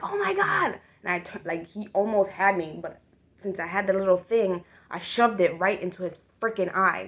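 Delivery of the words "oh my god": high startled oh my God; shocked gasp. Retold or imitated. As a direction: squeal it in shock. Friend reacts in shock.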